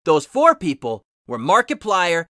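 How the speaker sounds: usual speaking voice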